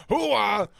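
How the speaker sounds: high shouted hooah; gung-ho hooah. Acted. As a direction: yell it like Pacino. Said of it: "Bad Pacino".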